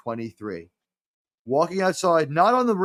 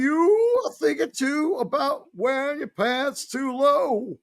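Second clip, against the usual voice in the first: Bill Cosby impression; 11 semitones higher than usual. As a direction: preach it like an old comedian lecturing. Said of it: "I wouldn't recommend doing that voice".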